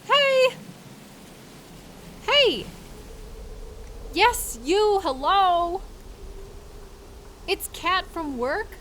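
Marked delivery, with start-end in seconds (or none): 0.0-5.8 calling out. Acted. Driver shouting to her coworker outside. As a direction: hail someone from the car window.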